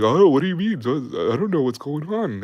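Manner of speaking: clueless soldier. Retold or imitated. Johnson answering the sergeants, a put-on innocent voice. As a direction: play dumb like you have no idea. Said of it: "He's like".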